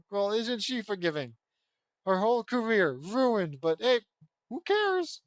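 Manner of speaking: jeering high-pitched who cares; sarcastic. Real, not acted. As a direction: scoff at how easily she forgives. Mocking the character's forgiveness.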